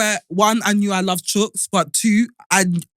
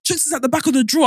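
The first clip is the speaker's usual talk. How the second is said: panicked; 7 semitones higher, faster than usual. Retold or imitated. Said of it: "like, crying, like".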